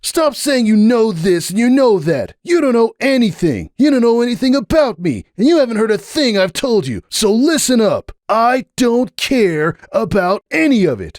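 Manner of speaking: furious high-pitched stallion; angry outburst. Acted. Hoops lashing out at Sunburst at the door, furious.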